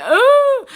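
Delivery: high, loud mock wail; upset wail. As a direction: wail like someone who won't calm down. Wails when told to calm down.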